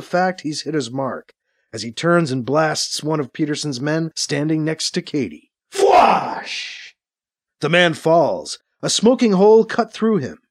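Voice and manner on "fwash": raised, whooshing energy-gun shot; rifle blast sound. A narrator's reading. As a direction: imitate a laser blast with the mouth. Narrator voices rifle blast sound effect.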